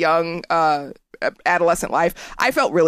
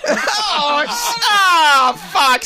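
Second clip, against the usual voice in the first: startled outburst; 7 semitones higher, much slower than usual. Real, not acted. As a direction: yelp it out in shock.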